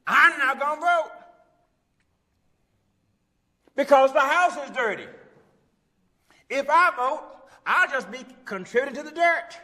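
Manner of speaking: high, loud, indignant refusal; mock non-voter. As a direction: belt out a silly excuse, high and stubborn. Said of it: "How many folks have you said something like that?".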